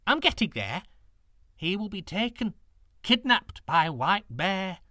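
lightly voiced old woman, mournful. A narrator's reading. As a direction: voice the old woman, grieving at the end.

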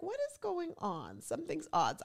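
a hint of a suspicious pet sniffing around; voicing the dog.

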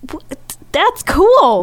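tripping over the first word; stammering. Real, not acted. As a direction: stutter the start, then get it out. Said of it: "You're, like, stammering".

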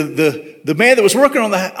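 usual speaking voice

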